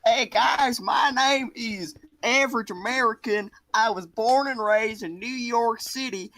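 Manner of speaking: high-pitched, hammed-up New York accent; exaggerated New York accent introduction. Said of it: "This is a New York accent".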